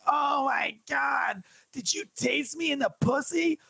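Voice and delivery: high, stretched-out yell of a tased woman; mock scream of pain and outrage; imitating her drawn-out shout; shocked shout.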